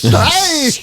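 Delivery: high, loud Irish hey; a hammed-up Irish shout; Irish accent. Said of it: "My Ir-, Irish".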